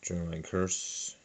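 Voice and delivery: ordinary talk, nothing marked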